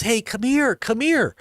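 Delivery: imitating a stranger's urgent beckoning; man calling people over. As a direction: call them over, pitch up then dropping. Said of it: "he says".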